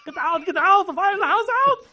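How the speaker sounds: very high, strained comic yelling; mock shouting. Co-host riffing on an angry dad, hammed-up high shouting. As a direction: yell it out in hammed-up alarm.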